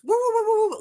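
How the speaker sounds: high-pitched string of little woofs; imitated yippy dog barking. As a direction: yap like an irritating small dog. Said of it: "yippy dogs".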